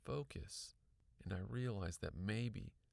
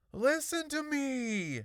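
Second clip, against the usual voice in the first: high, loud, drawn-out demand; panicked shriek for attention; shrill, panicky; 8 dB louder, slower than usual. A narrator's reading. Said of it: "my shrill, panicky voice".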